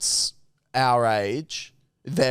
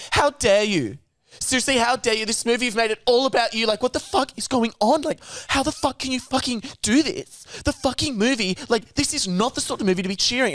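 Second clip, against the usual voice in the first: high, fast, shrill-free indignant rant pitched way up; outraged guy's rant; 10 semitones higher than usual. Retold or imitated. Man scolding cheering brother at premiere, high outraged rant. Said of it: "he starts to go".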